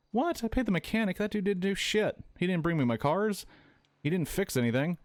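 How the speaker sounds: real gamer outrage at being charged; indignant outburst. Real, not acted. Outraged at game bills mid-play.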